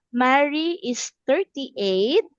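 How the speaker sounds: normal talking voice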